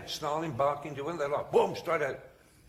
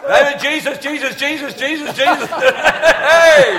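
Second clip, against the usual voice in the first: high, loud repeated bursts; machine-gun shouting; 12 semitones higher, 19 dB louder than usual. Retold or imitated. Bishop casting out demons, machine-gun shouting. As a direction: fire out the name like rounds from a gun. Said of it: "like a machine gun".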